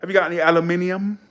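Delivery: mock British question; British accent. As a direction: say "aluminium" the British way, al-yoo-MIN-ee-um. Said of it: "Or aluminium".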